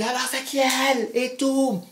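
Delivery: put-on high, sharp confrontational girl voice; jealous girlfriend. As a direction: demand who she is, high and biting. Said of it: "Et elle dit".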